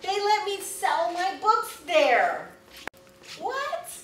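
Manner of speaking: slow, loud, then a squeaky high What; excited disbelief. Real, not acted. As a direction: savour it, then squeal in surprise. Disbelief about selling books.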